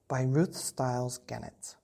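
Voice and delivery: plain reading voice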